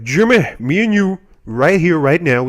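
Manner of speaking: loud challenge, starting high; mock fighting challenge. Billy challenges Jimmy to fight, mock-tough and loud. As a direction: imitate a kid calling someone out to fight. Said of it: "he wants to fight Jimmy".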